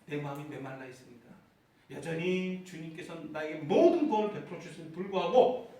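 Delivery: usual speaking voice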